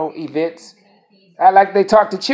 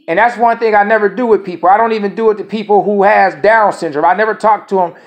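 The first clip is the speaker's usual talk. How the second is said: ordinary talk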